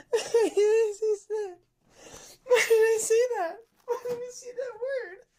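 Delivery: mortified, laughing through tears; crying with laughter. Real, not acted. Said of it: "I'm crying".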